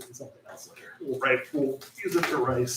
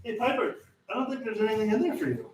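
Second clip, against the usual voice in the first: talking to a pet; 7 semitones higher than usual. Real, not acted.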